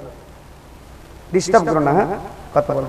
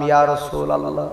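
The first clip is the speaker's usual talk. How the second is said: quiet, softer invocation; soft devout utterance; 4 semitones lower, 7 dB quieter than usual. Retold or imitated. Preacher recalling his words in the barber's chair, softly. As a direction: murmur the name softly, devoutly.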